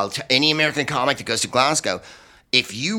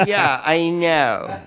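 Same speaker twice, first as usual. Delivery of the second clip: slow, much higher, laughing; laughing resigned; 5 semitones higher, much slower than usual. Retold or imitated. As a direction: admit it through laughter. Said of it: "I go".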